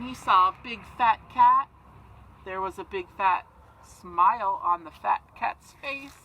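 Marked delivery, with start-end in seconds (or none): none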